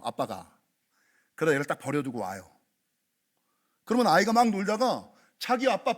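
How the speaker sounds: ordinary delivery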